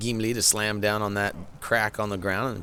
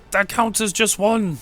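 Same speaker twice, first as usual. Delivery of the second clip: game character voice; 13 semitones higher, 7 dB louder, slower than usual. Retold or imitated. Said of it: "It says".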